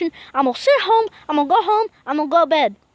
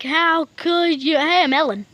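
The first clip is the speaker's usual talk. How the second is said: ordinary talk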